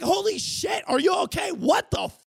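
high alarmed yell; alarmed shout. Pretend. Imagining finding a woman adrift, a high alarmed yell. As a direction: yell it like you found someone in the sea. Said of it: "I'd be like".

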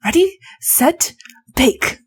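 lightly sung-out countdown, slow; mock host's starting call; show-host catchphrase. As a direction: call the contest start, spaced word by word.

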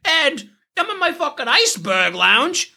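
very high, cartoonish mobster impression; gabagool mafia guy. Podcast host mocking the film's overly Italian villain, gabagool mafia impression. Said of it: "he's a very gabagool mafia guy".

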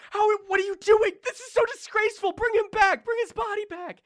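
high, loud furious scolding; queen berating the god at her husband's funeral; outraged queen. Acted. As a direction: tear into him with outrage. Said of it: "punching them and just saying".

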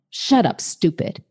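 ordinary talk

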